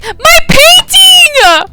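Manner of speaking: high, drawn-out cry of dismay; dismayed shout. Real, not acted. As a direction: wail it out slowly. Painting wrongly replaced.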